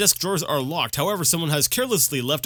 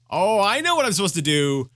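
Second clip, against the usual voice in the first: normal talking voice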